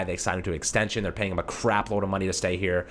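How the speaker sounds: ordinary delivery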